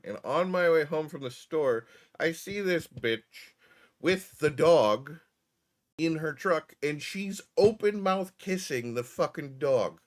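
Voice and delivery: dragging out the disbelief; incredulous. Real, not acted. Telling viewers about the woman with the dog, incredulous and slow.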